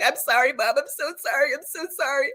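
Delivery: put-on high-pitched pleading kid voice; piping, repeated mock apology; imitating apologetic child. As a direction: beg Mom's forgiveness in a child's voice. Child's frantic apology, retold.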